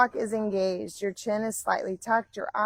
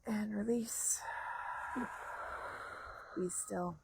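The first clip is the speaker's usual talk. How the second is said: guided meditation; 2 semitones lower, 11 dB quieter than usual. Acted. Quiet meditation cues.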